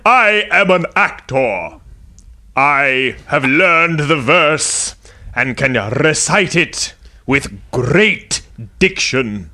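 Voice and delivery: Ian McKellen. Acted. Mocking the British stage actor, hammed-up grand theatrical voice. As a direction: boom it out like a stage thespian. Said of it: "You sound like Ian McKellen".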